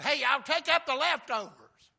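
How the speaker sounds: high, loud call to a group. Acted. Jesus telling disciples to gather leftovers, calling out. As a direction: holler it across a crowd.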